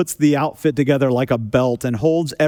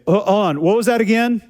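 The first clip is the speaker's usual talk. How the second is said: higher, puzzled question; confused drive-thru kid; 7 semitones higher than usual. Retold or imitated. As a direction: ask it like you lost the order. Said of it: "they say".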